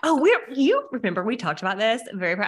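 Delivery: normal talking voice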